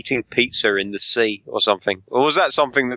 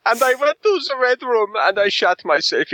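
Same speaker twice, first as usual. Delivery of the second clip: German villain voice; 13 semitones higher than usual. Acted. S1 acts out Dotcom's story.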